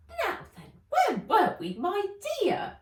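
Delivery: very high, sugary elf voice; as if nothing had happened; sweet elf. Acted. Elf turning back to Nat, high and sweet. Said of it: "as if nothing had happened".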